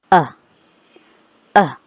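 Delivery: short neutral uh; a relaxed unstressed uh; bare schwa demo; schwa sound. Shown as a demonstration. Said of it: "which sounds as uh".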